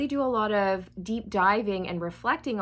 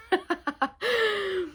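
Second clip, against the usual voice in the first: high-pitched delighted giggle; high laugh; 11 semitones higher than usual. Real, not acted. Laughing over the ancestor's ghost writing, high giggle. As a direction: laugh out high, mid-story.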